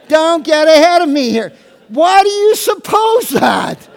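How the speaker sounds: joking shout at the congregation; teasing shout. Pretend. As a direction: call out to the crowd, hamming it up.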